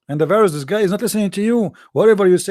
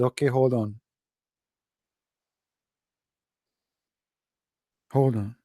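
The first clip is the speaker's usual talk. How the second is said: lower, softer, patient; calm reply; 6 semitones lower, 5 dB quieter than usual. Retold or imitated. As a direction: lay the trap calmly. Speaker's calm reply in the story.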